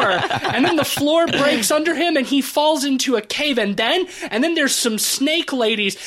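put-on breathless, high excited child voice; excited kid rambling. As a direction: gush it out like an overexcited little kid. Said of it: "It feels like a little child that's being like".

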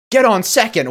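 high-pitched playful call to teammates; mock shout. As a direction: call it out like a ballplayer on the field. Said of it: "he shouts to the other pigs".

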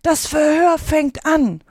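high, drawn-out call; distant call. A narrator's reading. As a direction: call it out slowly like an announcement. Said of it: "als ein Ruf".

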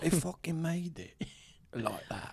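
put-on slow British accent, dropping away; drawn-out mock British complaint; Jason Statham. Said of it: "had to do the accent".

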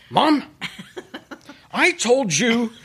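put-on high whiny yell of a small boy; demanding child yell. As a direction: shout it at Mom like a cross four-year-old. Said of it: "He's like".

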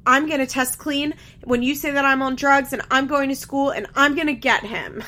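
retelling a mom threatening grandma, hammed-up defiance; mock defiant threat. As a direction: sneer the sweetheart like a threat. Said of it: "Janelle is like".

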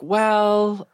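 high, drawn-out hedging; reluctant sing-song stall. Retold or imitated. Retold partner hedging instead of backing.

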